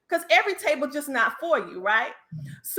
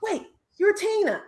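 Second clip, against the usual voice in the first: surprised fan; 9 semitones higher than usual. Retold or imitated.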